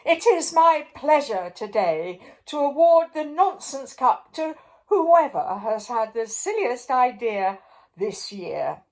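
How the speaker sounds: high, loud royal announcement; pompous king hailing his subjects; king's address. A narrator's reading. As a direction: proclaim it grandly to the crowd. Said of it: "he said to the crowd".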